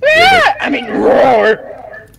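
squeaky dinosaur roar. Pretend. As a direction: shriek then try to roar. Shriek corrected to roar.

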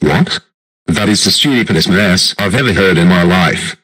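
loud, indignant outburst; outraged. Acted. Biden hears the plan to enter his mouth, outraged. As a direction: shout it down in outrage.

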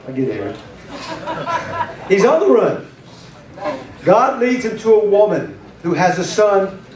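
ordinary delivery

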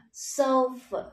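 sofa stressed on "so", falling to a short "ơ"; first-syllable stress. Shown as a demonstration. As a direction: hit the start of sofa, drop the tail. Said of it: "Âm tiết thứ nhất đúng không nào?". (sofa nhấn âm tiết đầu)

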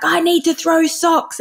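put-on high, slow, drawn-out child's demand; angry child. Child telling a parent about throwing socks, high angry demand. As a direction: play an angry kid in a high voice.

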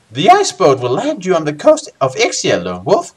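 high, swooping character voice; Baindon's voice. Acted. Guildmaster briefing Lone Wolf on the voyage, high put-on voice. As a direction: put on a high guildmaster voice with big pitch swings. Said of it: "says Baindon".

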